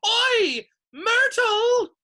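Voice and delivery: very high, loud call; bellowing. A narrator's reading. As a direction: bellow across the room as an impish poltergeist. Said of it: "took a deep breath and bellowed".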